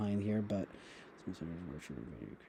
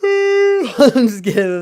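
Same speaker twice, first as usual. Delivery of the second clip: laughing; 18 dB louder, much slower than usual. Real, not acted. Laughing after a yawn.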